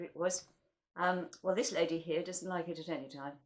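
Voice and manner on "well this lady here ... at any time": usual speaking voice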